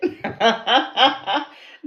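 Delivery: loud, high burst of laughter; delighted laugh. Real, not acted. Laughing at her own accent attempt, delighted. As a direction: crack up loudly.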